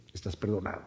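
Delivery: tender forgiveness. Acted. The Lord telling the humbled sinner he is forgiven, very soft. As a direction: let the forgiveness land gently and low.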